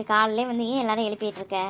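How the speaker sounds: ordinary talk, nothing marked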